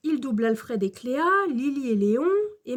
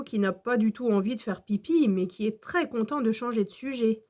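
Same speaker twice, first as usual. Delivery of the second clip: ordinary delivery, nothing marked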